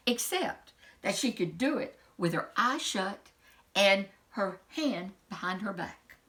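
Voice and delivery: ordinary delivery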